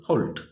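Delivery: হোল্ট said as holt, with a rounded o; হোল্ট with an o sound, like hold plus t; pronunciation demo. Shown as a demonstration. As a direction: say হোল্ট as "holt", not "halt". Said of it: "আমরা হোল্ট বলি এইভাবে".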